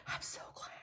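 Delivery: very soft, voiceless whisper; hushed, spent relief. Real, not acted. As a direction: sigh it out under your breath. Relief repeated under her breath.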